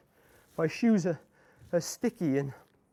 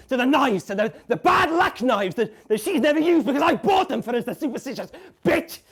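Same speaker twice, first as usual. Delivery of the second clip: rising frantic shout; 7 semitones higher, 4 dB louder than usual. Acted. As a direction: voice climbs higher and louder into a rushed rant. Frenzied rush to the knives.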